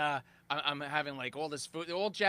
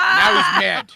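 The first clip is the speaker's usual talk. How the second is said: mad; 12 semitones higher, 14 dB louder, slower than usual. Retold or imitated. Retelling CEO anger.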